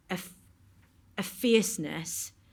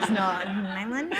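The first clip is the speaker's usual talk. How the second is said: laughing; 3 dB louder than usual. Retold or imitated. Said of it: "I was like".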